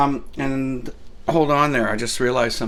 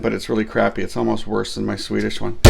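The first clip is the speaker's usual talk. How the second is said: usual speaking voice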